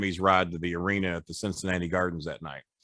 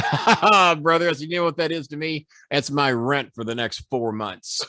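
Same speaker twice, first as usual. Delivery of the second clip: mocking laugh back; 4 semitones higher than usual. Mocking Flair back over the rent money, laughing. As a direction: throw the laugh right back at him.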